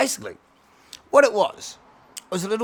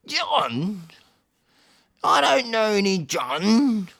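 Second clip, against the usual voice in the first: put-on loud, higher, drawn-out old-leader voice; old cat leader; 4 semitones higher, 5 dB louder, much slower than usual. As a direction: stretch it out like a puzzled village elder. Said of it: "the old cat fucking leader".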